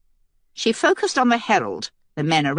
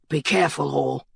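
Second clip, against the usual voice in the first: warning; 4 semitones lower than usual. A narrator's reading. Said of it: "Penn warned".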